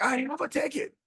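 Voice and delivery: mock squeal of protest at normal volume; shrill retort. Retold upset passenger insisting she has one.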